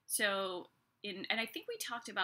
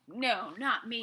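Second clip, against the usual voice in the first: louder, brash protest as Peter; brash, bold; 6 dB louder, slower than usual. Retold or imitated. Peter protesting to Jesus that he won't fall, brash. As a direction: bold denial, punching "No" and "me". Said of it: "Peter's being all brash and bold".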